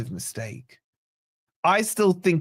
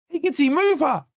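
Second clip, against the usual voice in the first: shouted demand; 9 semitones higher than usual. Acted. Chanting at streamer to move daughter.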